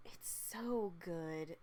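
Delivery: slow, soft, almost to yourself; hushed slow "It's so good"; soft and dreamy. Real, not acted. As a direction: savour "so good" very slowly and softly.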